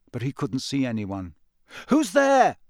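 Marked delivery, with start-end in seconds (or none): 1.9-2.6 high, loud call into the dark. A narrator's reading. Harry searching for the hidden conjurer, calling out. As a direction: call out sharply to someone unseen. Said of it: "he called again".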